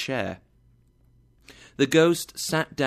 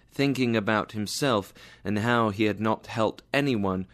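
ordinary delivery, nothing marked

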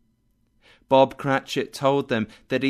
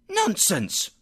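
indignant cry; 9 semitones higher than usual. Acted. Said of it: "cried Missus Cratchit, reddening".

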